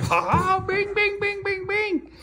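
very high squeaky bing chant; squeaky praising a dog. Real, not acted. Celebrating again.

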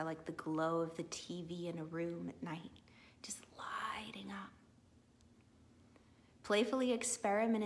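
calm guide, sinking into breathy near-whispers at times; guided meditation. Real, not acted. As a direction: lead them gently, with long pauses between thoughts. Leads a guided visualization.

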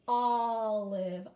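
"Olive" with a long, loud open ah at the start; stressed first vowel. Shown as a demonstration. Teacher models the word for the class, stressed. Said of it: "Say olive with me".

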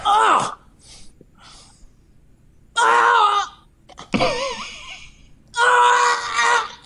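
high straining groan, held long; hammed-up effortful "ah"; strained groan. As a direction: grunt and strain as if nothing will come. Acting out straining on top of her.

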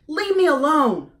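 high, loud gosling yell; squawked. A narrator's reading. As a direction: shout it at mother, wanting to be left alone. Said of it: "he squawked".

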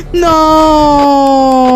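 loud, high drawn-out yell; real dismayed shout. Real, not acted. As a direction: yell out in defeat. Loses to a game jumpscare.

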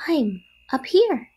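high little spider voice; small voice. A narrator's reading. Baby spider calls from the doorway, small voice. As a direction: call down lightly in a high voice. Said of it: "he heard a small voice".

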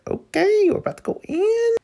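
very high, fast, thrilled squeal; excited squeal. Real, not acted. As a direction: squeal it like a fan. Excited squeal over the scene.

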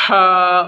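ہا from the throat; throaty ha. Shown as a demonstration. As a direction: make ہا come from the throat. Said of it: "جیسے میری آواز نکل رہی ہے".

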